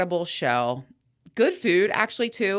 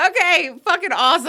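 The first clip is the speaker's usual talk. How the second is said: excited; 11 semitones higher, 7 dB louder, faster than usual. Retold or imitated. Her excited reply in story.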